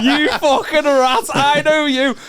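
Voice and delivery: high, slow mock accusation; mock outrage. Real, not acted. Friend teasingly accusing the comic of being a rat. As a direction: call him out in playful outrage.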